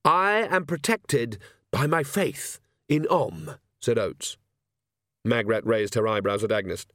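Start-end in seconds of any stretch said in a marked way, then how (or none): 0.0-3.5 slow, higher, solemn declaration; slightly pompous, deliberate cleric; earnest priest. Acted. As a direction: profess your faith with weighty pauses. Priest solemnly declares his faith.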